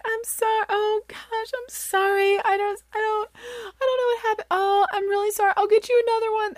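high, teary pleading sorry; guilty mom begging crying kids' forgiveness; frantic apology. Retold or imitated. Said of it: "and I'm like".

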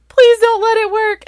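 very high, loud wail of dread; desperate child plea. Retold or imitated. Daughter as the car nears the sign, desperate loud plea. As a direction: imitate a kid begging at full pitch.

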